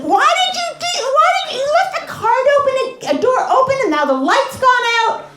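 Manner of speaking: imitating the yelling husband; mock angry husband. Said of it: "he says".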